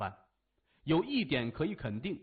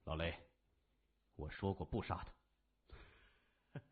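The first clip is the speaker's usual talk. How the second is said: lower, softer and slow; frowning warning; 9 semitones lower, 10 dB quieter, slower than usual. A narrator's reading. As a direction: restrain him with a quiet word. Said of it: "谢文东皱眉说".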